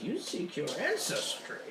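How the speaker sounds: high, mystic seer voice; a voice speaking inside your head; fortune teller voice. Acted. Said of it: "you hear the fortune teller's voice in your head".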